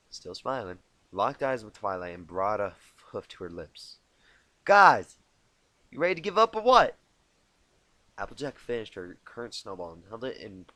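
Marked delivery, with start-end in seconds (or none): 4.6-7.0 higher, louder and fast; taunting call. Acted. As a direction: rattle off the dare. Rainbow Dash calling out.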